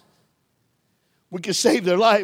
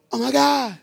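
put-on little boy voice; 6 semitones higher than usual. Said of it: "the little boy go".

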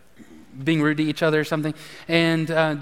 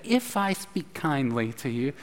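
mock sweet quote; 4 semitones lower, 6 dB quieter than usual. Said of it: "remember earlier when you said".

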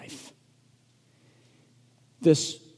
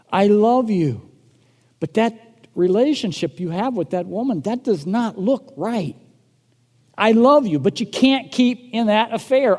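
louder, higher, driving sermon voice; preaching at full force; 5 semitones higher, 6 dB louder than usual. Acted. Pastor urging the church to step in, full-force preaching. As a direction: preach it out to the congregation, urgent.

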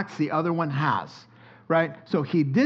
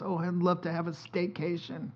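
soft mock wife; 2 semitones higher, 8 dB quieter than usual. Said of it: "She goes".

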